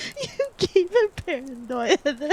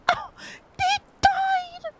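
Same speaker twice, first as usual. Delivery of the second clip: high, stunned cry of disbelief; he died, high and shocked; slower than usual. Real, not acted. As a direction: gasp it out in shock. Shocked at character death.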